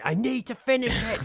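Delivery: high, stubborn insistence. Retold or imitated. Ignoring his wife's warning, a high stubborn insistence. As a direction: whine it like a stubborn kid. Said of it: "And I was like".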